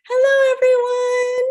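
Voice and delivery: high, loud puppet greeting. Pretend. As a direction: call to everyone as a little ox, very high. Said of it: "Thank you, Mister Ox".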